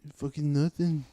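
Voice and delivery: mock Irish fighter's accent; trash-talk in a put-on Irish brogue; Conor McGregor. Fighter taunting his boxing opponent, imitated Irish accent. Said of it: "straight Conor McGregor".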